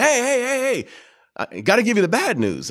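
high urgent interrupting hey hey; mimicking the caller cutting in with bad news; imitating agent. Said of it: "And he's like".

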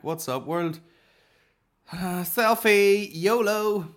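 loud, high, drawn-out selfie holler; mock-cheery yell to the phone camera; hyped selfie shout. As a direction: whoop it up for the world. Acts out bear victim filming a selfie.

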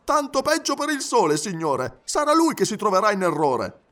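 high, defiant valet's voice; animated high-pitched retort; stubborn Passepartout, indignant. Acted. Passepartout stubbornly defends watch.

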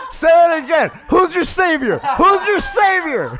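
high, loud, laughing gloat; playing savior to the rescued guy; laughing taunt. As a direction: crow over him like a hero. Teasing the saved man.

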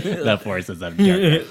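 mock mouthful of ice, slow; slow slurred ice cube imitation; imitating ice in mouth. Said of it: "the way you said that with an ice cube in your mouth".

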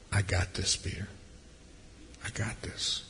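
soft, hushed reassurance, fading to a whisper; a whispered promise that all is well; tender whisper. Acted. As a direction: soothe him gently, almost breathing it. Jesus' compassionate look voiced.

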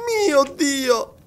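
high cry of overwhelmed joy; tearful exclamation. A narrator's reading. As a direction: exclaim high, on the verge of tears. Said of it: "non potendo trattenere le lacrime".

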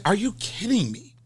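high-pitched mock disbelief. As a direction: pitch it up, scandalised.

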